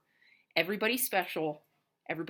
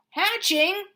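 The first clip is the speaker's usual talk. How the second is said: much higher, louder alarmed questions; panicked Elephant; 13 semitones higher, 12 dB louder than usual. Acted. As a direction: panic as the eggs hatch. Elephant panics over hatching.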